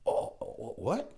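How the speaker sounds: stammered, high-pitched astonishment; breathless incredulous gasp; mock shock. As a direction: imitate someone too stunned to take it in. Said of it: "that was just a shock to them".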